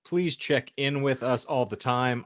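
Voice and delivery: ordinary talk, nothing marked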